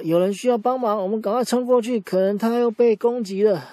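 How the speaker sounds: lion leader rallies his team, urgent and loud; urgent, alarmed. Acted. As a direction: call the team to action, alarmed. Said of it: "狮子王又很紧张".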